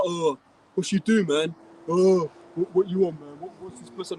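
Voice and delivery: lightly voiced mocking of bored young people; a hint of aimless street kid, drawling; mocking idle youths looking for mischief, casual put-on voice. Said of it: "they're like".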